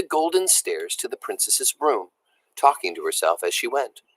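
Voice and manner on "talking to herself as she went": usual speaking voice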